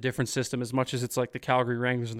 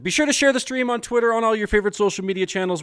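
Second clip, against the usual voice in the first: higher, upbeat promo read; announcer plug; 8 semitones higher, 3 dB louder, faster than usual. Acted. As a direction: pitch the share like a radio host.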